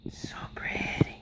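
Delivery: breathless soft whisper of awe. Real, not acted. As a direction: whisper it in wonder. Whispered admiration.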